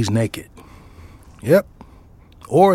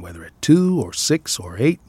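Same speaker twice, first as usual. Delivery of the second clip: plain reading voice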